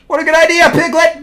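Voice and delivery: high, keen little character voice; high storybook dialogue. Acted. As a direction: pipe it up high.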